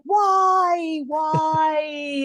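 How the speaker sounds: high, drawn-out mock despair; mock wailing. Co-host moaning about endlessly discussing Westworld, mock wailing. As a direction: wail it out like a hammed-up lament. Hammed-up complaint about a show.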